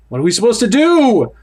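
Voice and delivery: frightened, rising, urgent; panicked high voice. Acted. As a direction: voice racing up in pitch. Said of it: "My voice races in pitch".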